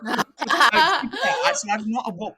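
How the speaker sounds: high, loud laughing backtrack. Real, not acted. Friend laughs off a joke about rival queens. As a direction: laugh it off and take it back. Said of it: "I'm just kidding".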